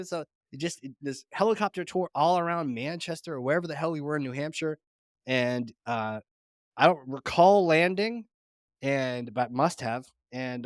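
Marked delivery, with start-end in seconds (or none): none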